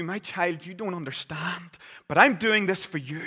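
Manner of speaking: a hint of a solemn father, preached loud; a father pleading to be understood; preached father explaining to his child; earnest father.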